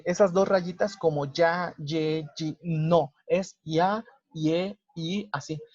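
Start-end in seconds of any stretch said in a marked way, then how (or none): none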